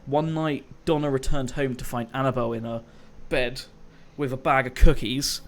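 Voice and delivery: usual speaking voice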